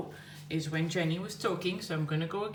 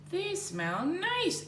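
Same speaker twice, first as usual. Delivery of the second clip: put-on high sweet girl voice, drawn out slowly; a sing-song little girl voice; 13 semitones higher, much slower than usual. Shown as a demonstration. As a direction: lift it way up like a delighted child. Voicing Jenny again.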